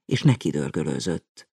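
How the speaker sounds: ordinary delivery, nothing marked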